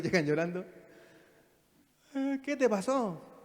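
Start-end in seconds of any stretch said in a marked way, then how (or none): 2.1-3.4 parent voice. Retold or imitated. Parent asks crying child.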